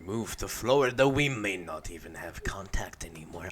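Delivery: put-on soft, low European-accented delivery; vaguely European accent, hushed and trailing off. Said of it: "I'm doing the European voice right now".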